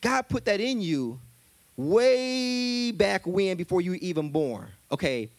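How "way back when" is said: very slow emphatic stretch; very slow drawn-out. Real, not acted. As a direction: stretch every word way out.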